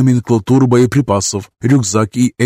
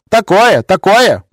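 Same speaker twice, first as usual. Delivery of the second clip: high squeaky robot echo; robot nanny voice; 9 semitones higher, 5 dB louder, slower than usual. Acted. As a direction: chirp it back, much higher. Robot nanny repeats excitedly.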